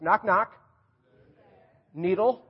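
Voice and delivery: loud, high knock-knock delivery; joke call-out. Pretend. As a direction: call it out for the crowd to answer. Tells congregation a knock-knock joke.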